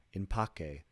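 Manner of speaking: a quiet prayer over the tomb; hushed farewell. Acted. Montresor blessing the sealed niche softly. Closing Latin benediction, hushed.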